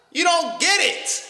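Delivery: high, exasperated squeak. Real, not acted. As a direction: pitch it way up in frustration. Exasperated retort to critic.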